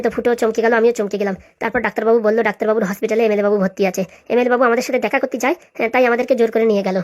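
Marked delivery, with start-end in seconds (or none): none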